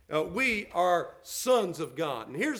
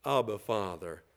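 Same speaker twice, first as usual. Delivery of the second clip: put-on childlike cry to the father; 8 semitones lower than usual. Shown as a demonstration. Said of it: "we're crying".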